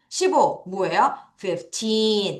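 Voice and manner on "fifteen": put-on Fifteen with a long, strong TEEN; fif-TEEEN, ending held; stress on TEEN. Shown as a demonstration. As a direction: stretch the second syllable of Fifteen. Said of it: "틴을 강하게 읽겠습니다".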